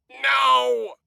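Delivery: high, loud desperate scream. A narrator's reading. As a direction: scream it in pain and refusal. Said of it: "Ashtron screamed".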